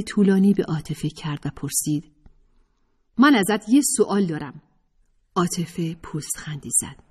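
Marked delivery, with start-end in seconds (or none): 3.2-4.6 put-on lightly raised girl's voice, serious; a hint of a determined student; Rahele's voice. A narrator's reading. (صدای راحله، جدی و مصمم)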